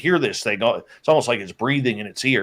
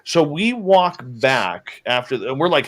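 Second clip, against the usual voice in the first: ordinary delivery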